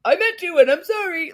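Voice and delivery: high, loud mock voice of Tom; lightly voiced sing-song fake apology. Imitating Tom's hollow apology to his cohost, high and mocking. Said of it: "Or he's gonna be like".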